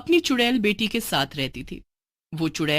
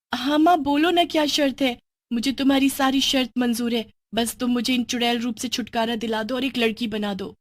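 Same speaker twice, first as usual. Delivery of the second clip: put-on young girl Bini; 4 semitones higher than usual.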